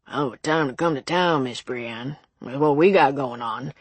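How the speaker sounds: put-on old small-town shopkeeper voice; folksy elderly cashier. Elderly cashier greeting the doctor's visiting sister, folksy. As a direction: play the chatty old local clerk.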